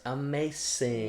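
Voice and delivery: put-on slow, drawn-out toddler amazement. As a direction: imitate a two-year-old, slowly. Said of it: "as our two-year-old says".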